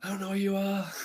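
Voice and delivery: high, frightened shouting; panicked yell. Retold or imitated. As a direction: push a stranger away in fear. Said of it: "I was like".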